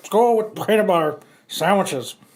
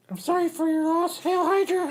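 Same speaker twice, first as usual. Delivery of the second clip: mock mourner; 9 semitones higher, 5 dB quieter than usual. Mourner mocking Hydra funeral.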